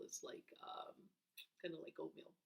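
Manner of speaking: quiet unvoiced aside; whispered aside. Real, not acted. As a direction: lean in and whisper. Reader aside to listener.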